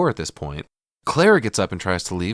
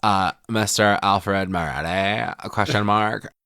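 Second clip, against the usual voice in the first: slow, dopey stoner drawl; mock interviewer in a dumb lazy voice; stupid voice; slower than usual. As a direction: talk like a spaced-out Valley Girl. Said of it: "he starts doing the stupid voice".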